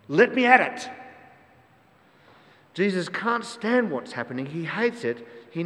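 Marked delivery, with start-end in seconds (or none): 0.1-0.9 higher, louder, straining to charge; like a charger rearing up, yelling to be let loose; eager battle cry. Acted. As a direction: voice a war horse itching for the fight. War horse rearing before charging.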